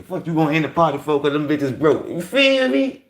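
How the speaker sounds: loud, high, worked-up rant. Real, not acted. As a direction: shout it out, fired up. Ranting about broke women.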